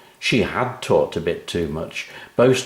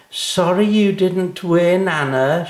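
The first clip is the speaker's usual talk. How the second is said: smug call; 10 semitones higher, 5 dB louder than usual. Acted. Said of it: "she called".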